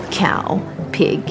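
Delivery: ordinary delivery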